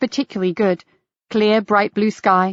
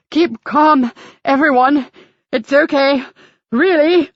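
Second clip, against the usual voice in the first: high, louder breathless calming; gasped reassurance; 5 semitones higher, 3 dB louder, slower than usual. Acted. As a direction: gasp it out, trying to calm everyone. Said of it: "he gasped".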